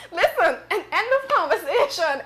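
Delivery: laughing while cutting it off; giggling "end of conversation". Real, not acted. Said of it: "I can't".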